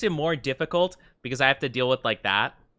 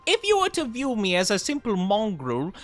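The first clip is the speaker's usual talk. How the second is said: higher, overly formal villain speech; mock-posh Joker speaking in elaborate phrases; posh fancy Joker; 7 semitones higher than usual. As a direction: stretch every word like a pompous gentleman Joker. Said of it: "every single Joker line just, like, supremely stretched out in the fancy speech".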